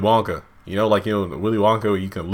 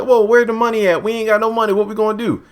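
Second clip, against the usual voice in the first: pitched way up, fretting mock panic; high mock worried wife; 10 semitones higher, faster than usual. Worried wife asking husband about money, high mock panic. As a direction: fret it up high like a broke wife.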